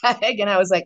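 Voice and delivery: ordinary talk, nothing marked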